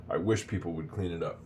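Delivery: ordinary delivery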